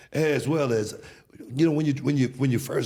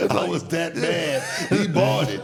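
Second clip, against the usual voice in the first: laughing; 8 semitones higher, 3 dB louder, slower than usual. Real, not acted.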